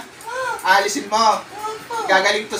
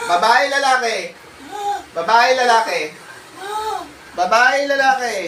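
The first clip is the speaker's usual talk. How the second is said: interrogating the spirit; 3 semitones higher than usual. Real, not acted. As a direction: force an answer out of it. Exorcist demands answer.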